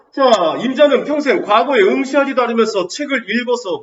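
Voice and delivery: loud, high declaimed reproach; reproachful wife. Acted. Hungry wife questions her bookish husband, reproachful. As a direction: read it out forcefully as a wife's challenge, drawing out the end. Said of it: "아내가 말하는 거잖아".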